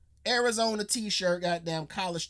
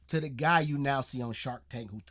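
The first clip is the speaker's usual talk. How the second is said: normal talking voice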